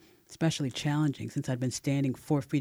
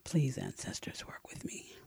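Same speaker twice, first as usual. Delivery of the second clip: silent prayer; 8 dB quieter than usual. A narrator's reading. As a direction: pray under your breath to the ancestors. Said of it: "silently praying".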